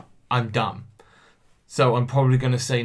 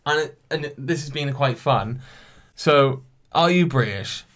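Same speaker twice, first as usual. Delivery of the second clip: usual speaking voice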